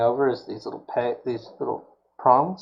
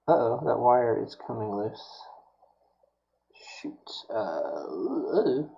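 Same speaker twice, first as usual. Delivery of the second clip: normal talking voice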